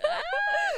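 very high, loud squeal; high laughing squeal. Real, not acted. Host overcome by her own muddle, squealing with laughter. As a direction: squeal helplessly mid-laugh.

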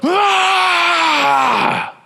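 loud, high sustained scream; drawn-out cry at full pitch; long scream. Acted. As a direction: scream out as if hit by a mighty wind. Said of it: "It's almost like this moment of".

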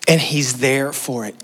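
ordinary talk

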